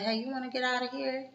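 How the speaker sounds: high, soft, quick come-on; flirty invite. Retold or imitated.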